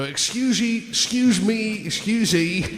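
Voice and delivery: high, put-on Cockney voice; old man in a Cockney accent. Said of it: "my Cockney accent".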